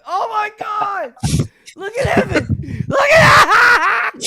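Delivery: high shouting, breaking into laughter; crying with laughter. Real, not acted. Reacting to friend's photo with wrestlers, crying with laughter. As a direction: shriek it, helpless with laughter. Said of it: "dude, I am fucking crying".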